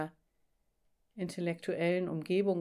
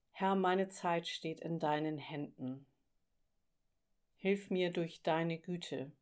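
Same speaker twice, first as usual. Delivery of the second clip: slow, hushed prayer voice. Acted. Prayer spoken in devotion. (ruhiges, gedämpftes Gebet)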